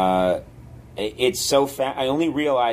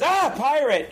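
startled shout; 14 semitones higher than usual. Retold or imitated. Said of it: "and goes".

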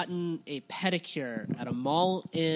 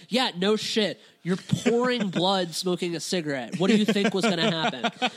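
loud, higher, incredulous rant; exasperated scolding; 4 semitones higher, 6 dB louder than usual. Retold or imitated. Waiting patient mock-scolds the slumped bleeding smoker, exasperated. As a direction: punch it out like stating the obvious.

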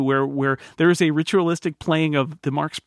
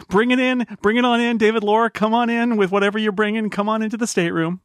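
excited welcome; 7 semitones higher than usual. Acted. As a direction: boom out the welcome like a showman. Host calls guest in loudly.

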